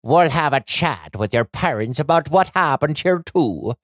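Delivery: put-on old Irish leprechaun woman; knowing Irish lilt. A narrator's reading.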